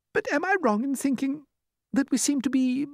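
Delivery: very high, hesitant falsetto; timid ladybird. A narrator's reading. Ladybird turning pale, high hesitant question. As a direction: ask it haltingly in a high voice. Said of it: "murmured the ladybird, turning a trifle pale".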